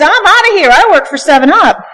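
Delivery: high, loud, hurried exclamation; panicked father. Retold or imitated. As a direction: blurt it out while bolting for the door. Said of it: "He says".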